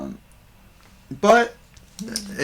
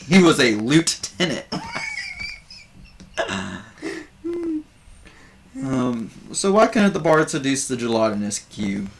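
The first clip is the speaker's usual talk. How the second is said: Buvor working the crowd as a nervous comedian; bard doing stand-up; 6 semitones higher than usual. Acted. Bard telling more jokes.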